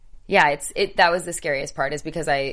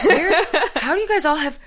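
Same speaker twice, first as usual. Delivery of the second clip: baffled surprise; 12 semitones higher than usual. Retold or imitated. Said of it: "And I'm like".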